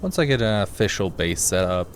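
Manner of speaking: normal talking voice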